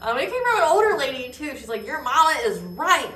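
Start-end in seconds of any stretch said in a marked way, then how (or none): 0.0-1.5 high laugh spilling into the words; laugh, then talk through it. Real, not acted. Mother adding a detail to the anecdote, laughing. 1.9-3.1 put-on slow, high, loud country accent. Older lady backing up the mother, country accent. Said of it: "in her country accent".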